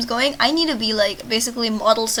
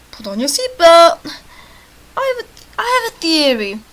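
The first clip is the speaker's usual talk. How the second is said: character dialogue; 5 semitones higher, 10 dB louder than usual. Acted. Voices game dialogue.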